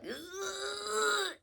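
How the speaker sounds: strained grunt. Retold or imitated. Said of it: "try to squeeze and push outward".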